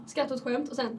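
ordinary delivery

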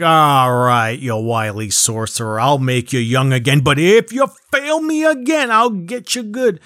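hammed-up overlord warning a minion; Shao Kahn. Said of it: "so then Shao Kahn's like".

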